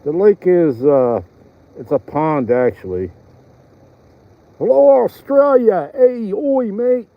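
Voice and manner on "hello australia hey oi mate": put-on a loud, high, playful stab at an Australian accent; mock Australian greeting. Greeting Australian viewers in a mock Australian accent. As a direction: hail the Aussies in a hammed-up Australian twang. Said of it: "Even your accents".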